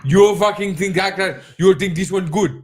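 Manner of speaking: mock an Indian man offended by the jab; mock Indian accent. Teasing a friend who mocks accents, in a mock Indian accent. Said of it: "Indian's funny".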